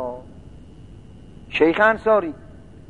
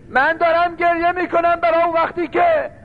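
same words again, higher and mournful; rising lament; 12 semitones higher than usual. Acted. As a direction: lift it into a mourning chant. Repeated line rising into lament. (تکرار با صدای بالاتر و سوزناک)

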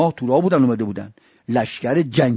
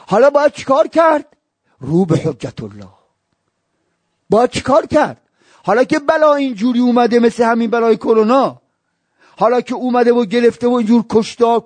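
high, loud, impassioned sermon cry; fiery preaching; 12 semitones higher, 5 dB louder than usual. Acted. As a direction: preach it to the crowd at full force. Preaching at full force.

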